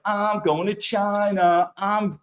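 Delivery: slow, sing-song gushing mimic of a thrilled traveler; mocking excited woman. Vlogger mocking the woman excited about her China trip, gushing. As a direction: lightly send up her breathless excitement. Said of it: "She's like".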